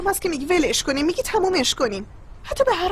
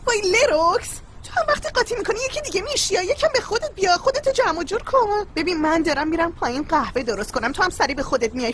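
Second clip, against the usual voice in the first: usual speaking voice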